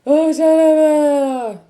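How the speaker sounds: long, high mock roar; drawn-out wild yell, imitating labor ravings; acted-out crazy outburst. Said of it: "I'm just like".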